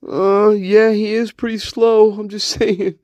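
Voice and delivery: high-pitched character voice; teasing spirit guide. Pretend. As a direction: chip in as a high, needling sidekick. Plays spirit guide teasing him.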